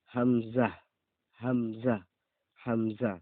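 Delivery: হামজা short and loud, no lengthening; teacher drills a short হামজা; clipped hamza. Shown as a demonstration. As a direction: punch হামজা short, no drawl. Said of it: "কোনো টান হবে না".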